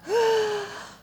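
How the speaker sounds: long dramatic gasp; shocked gasp. Acted. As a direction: gasp as if stunned. Acting out shock at life's blows.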